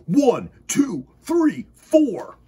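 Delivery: high, loud counting lizard; counting push-ups. Acted. Leo Lizard counting push-ups aloud, high and loud.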